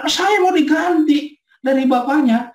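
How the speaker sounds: put-on high child voice, half-shouted; child's demand. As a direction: play the upset four-year-old. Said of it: "dia pakai hashtag ganti papa".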